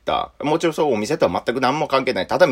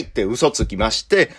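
ordinary delivery

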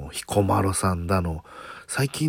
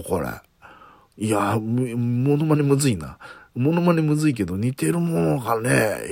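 ordinary delivery

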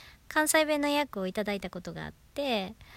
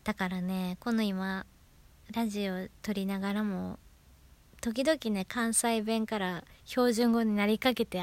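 ordinary delivery

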